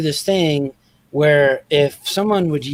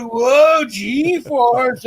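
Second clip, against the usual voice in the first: mock G-force cry; 6 semitones higher than usual. Dad's playful G-force cry.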